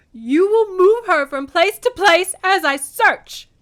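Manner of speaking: high, loud, slow anguished accusation; sobbed outcry. A narrator's reading. Desperate mother accuses Zeus as she searches, sobbing. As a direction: wail it at the top of the voice. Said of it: "she sobbed".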